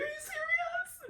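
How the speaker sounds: very high, drawn-out squeak; mock-crying high-pitched question; tearful disbelief. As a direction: imitate yourself squeaking in tearful amazement. Tearful disbelief at stranger's offer.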